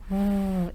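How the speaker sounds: sustained voiced "v" buzz, low and soft; isolated V sound. Shown as a demonstration. Teacher repeats the sound for learners, long buzzing V. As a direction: hold just the "v" so it vibrates. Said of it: "ele vibra".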